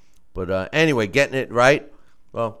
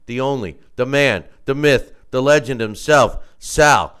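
grand entrance announcement; 4 semitones higher, 10 dB louder than usual. Acted. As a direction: ring announcer intro, building louder. Boxing ring style introduction.

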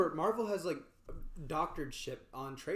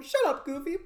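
high, loud cartoon squeak; Mickey Mouse voice; 10 semitones higher, 6 dB louder than usual. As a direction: snap it like Mickey. Acted Mickey voice.

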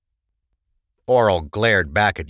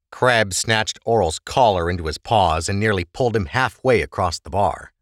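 ordinary delivery, nothing marked

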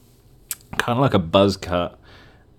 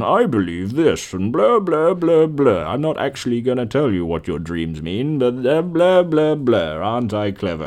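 a lightly voiced smug know-it-all; mocking the author; 4 semitones higher than usual. Mocking a dream book author, lightly smug voice. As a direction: parody a smug author, blah blah high. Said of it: "it's just some guy going".

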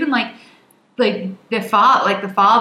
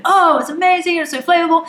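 higher-pitched foodie raving; mock gushing; 6 semitones higher than usual. Voicing usual talk about fantastic food, mock gushing. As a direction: lightly act out gushing praise.

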